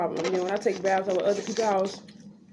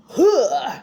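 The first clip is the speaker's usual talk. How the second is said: nurse's gasp; 5 semitones higher, 8 dB louder than usual. Retold or imitated. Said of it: "she said".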